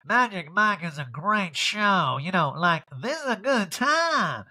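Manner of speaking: slow, high put-on drawl; exaggerated talking-head impression; Erica. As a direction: stretch the vowels in a mock endorsement. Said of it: "Erica and the Talking Head's like".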